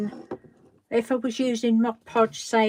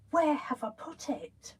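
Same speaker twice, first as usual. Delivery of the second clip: normal talking voice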